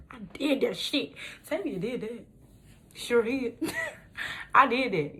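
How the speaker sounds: high, slow proud boast; proud celebration. Real, not acted. Celebrating the approval, high slow pride. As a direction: savor the win.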